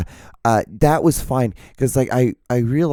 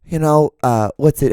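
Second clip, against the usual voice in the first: ordinary talk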